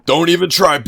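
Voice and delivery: high, loud chest-out swagger; cocky boast. Acted. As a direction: puff up and brag. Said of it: "puts his chest out and goes".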